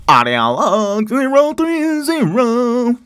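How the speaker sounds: drawn-out, high, loud intoning; mock log announcement. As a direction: intone it slowly like a grand announcement.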